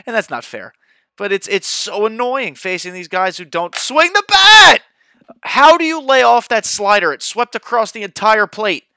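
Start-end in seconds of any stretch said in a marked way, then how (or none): 1.2-8.8 loud, exasperated gamer rant; raging gamer. Real, not acted. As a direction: vent loudly at the game. Frustrated at the pitcher in game.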